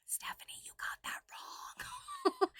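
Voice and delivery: a breathy, high, teasing whisper of a critic; mock whisper. Fans telling Stephanie she got it wrong, in a mock whisper. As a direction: whisper it like a know-it-all fan correcting her. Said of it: "our O Gs reach out to me and say".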